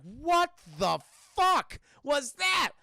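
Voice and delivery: slow, loud, high shout of disgust; mock horrified shout. Cops showered in remains shout slowly and loudly. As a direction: drag out the yell in shock.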